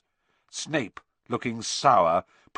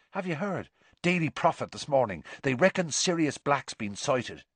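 eager schoolboy passing on news, pitched up; quick, high gossip to classmates; excited Seamus; 7 semitones higher than usual. Acted.

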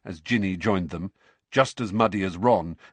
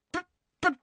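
much higher, tripping sputter; spluttered. A narrator's reading. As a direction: splutter in flustered protest. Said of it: "spluttered Harry".

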